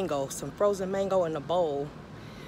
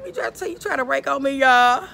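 loud, high playful complaint; loud teasing; 7 semitones higher, 8 dB louder than usual. Real, not acted. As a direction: call him out to the viewers. Playful calling out to viewers.

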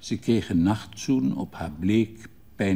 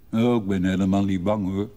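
fast, louder carefree call; 3 dB louder, faster than usual. Acted. Father brushes off his daughter's warning, fast and loud. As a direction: toss it off quickly and loudly, unafraid. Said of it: "riep de vader".

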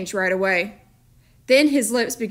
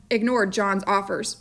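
ordinary delivery

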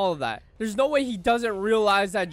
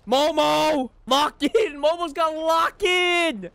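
raised, urgent rallying shout; cheering yell; 5 semitones higher, 3 dB louder, slower than usual. Real, not acted. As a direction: cheer on your fighter from the sidelines. Cheering the character on.

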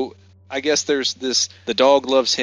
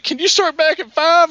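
high pleading voice; 4 dB louder than usual. Retold or imitated. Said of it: "and he's like".

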